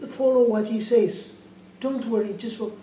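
imitated insistent coaxing; urging reassurance. Man urges her to follow.

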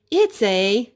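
ordinary delivery

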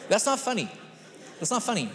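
mock scolding. Real, not acted. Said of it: "Those who are laughing".

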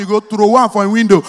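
ordinary talk, nothing marked

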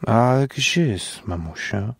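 put-on slow, dim childlike question; childish simpleton. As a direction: ask like a lost little kid. Johnek voiced as childish simpleton. (powolne, dziecinne pytanie)

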